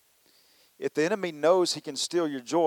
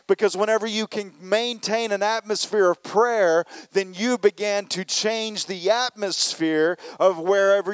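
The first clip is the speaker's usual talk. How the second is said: louder, higher preaching build; preaching at full force; 5 semitones higher, 5 dB louder than usual. Acted. Preacher rallying the church to change the atmosphere, full force. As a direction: drive it home to the congregation.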